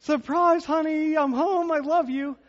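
high, bright-voiced husband calling out to his wife; husband pops out of trunk, sing-song surprise call; cheery surprise greeting. Husband surprising his wife from the trunk, high cheery call. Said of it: "this is where I go".

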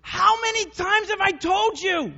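very high, slow mock-scolding; hammed-up exasperated parent. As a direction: stretch out the classic parent scold. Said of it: "have said this to your little kids".

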